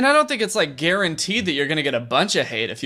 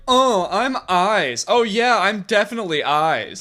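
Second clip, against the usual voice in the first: higher, drawn-out mock sarcasm; sarcastic; 5 semitones higher than usual. Sarcastic eyes speaking up for themselves, mock sarcastic. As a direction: play it as talking sarcastic eyes. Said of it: "Sarcastic eyes".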